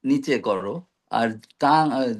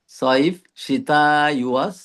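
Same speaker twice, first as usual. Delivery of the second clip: slow careful words; slower than usual. Shown as a demonstration. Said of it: "Saif মানে হচ্ছে".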